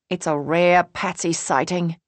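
hammed-up Australian wildlife narrator; bad Australian accent. Julie spotting Patsy, in a bad Australian accent. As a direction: put on a poor Australian accent. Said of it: "doing a bad Australian accent".

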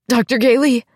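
high, loud, eager cry; desperately eager greeting. Acted. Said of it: "too eager, too desperate".

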